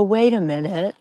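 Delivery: ordinary delivery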